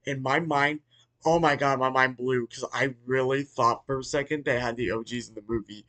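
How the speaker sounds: ordinary talk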